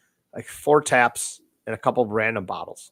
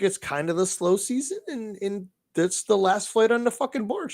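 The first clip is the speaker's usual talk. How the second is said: bartender voice; 7 semitones higher than usual. Retold or imitated.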